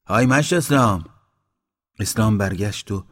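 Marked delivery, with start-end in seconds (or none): 0.0-1.0 high, loud hail; loud call. A narrator's reading. Mashti Baba hailing Islam in the village, loud call. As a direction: call out to get someone's attention. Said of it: "با صدای بلند گفت".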